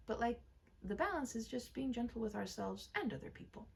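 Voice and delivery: plain reading voice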